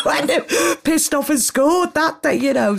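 high, slow, through laughter; laughing confession. Real, not acted. Telling friend about escaping to score, laughing. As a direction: laugh helplessly while telling it.

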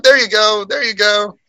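high sing-song taunt; mock cheerful high voice. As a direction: toss it off in a high teasing voice. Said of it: "I was just like".

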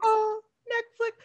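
high, drawn-out mimicry; Molly. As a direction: draw it out in a high imitation. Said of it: "in her Molly voice".

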